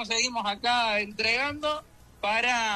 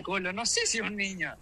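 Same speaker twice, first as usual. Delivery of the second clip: ordinary delivery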